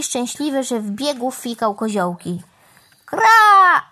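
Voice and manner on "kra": loud, high, drawn-out caw; crow's cawing shout. A narrator's reading. Crow overjoyed with his idea, cawing shout. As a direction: caw at full voice like an excited crow. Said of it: "Zawołał".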